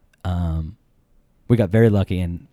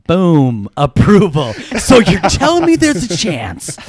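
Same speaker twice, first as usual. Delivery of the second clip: loud, higher mock celebration; triumphant; 7 semitones higher, 6 dB louder, slower than usual. Suitor celebrating dad's approval after the call, triumphant. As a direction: crow it like an unlikely victory.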